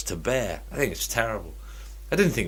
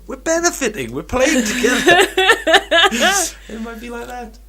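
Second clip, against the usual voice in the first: mock cheerful parent; 14 semitones higher, 8 dB louder than usual. Mock family bonding voice.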